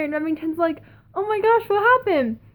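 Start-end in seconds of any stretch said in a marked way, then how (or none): 1.1-2.4 high-pitched astonished question; retold surprise, pitched way up; imitated shocked concern. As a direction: act out someone taken aback. Band member reacts to her arrival.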